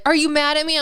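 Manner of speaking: loud, high mock challenge; teasing argument quote. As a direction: imitate a partner needling in an argument. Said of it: "likes to make fun of me".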